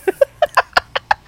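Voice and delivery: high, loud burst of giggles; laughing. Real, not acted. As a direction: crack up at your own silliness. Cracks up after silly crayon.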